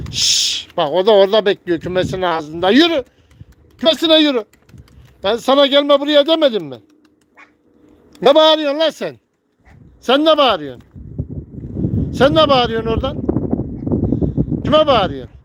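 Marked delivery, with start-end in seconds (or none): 0.1-0.7 1 shushing hiss. Real, not acted. Hushing a bird. 2.7-15.4 high, loud scolding yell; loud scolding of a noisy bird; angry shout. Real, not acted.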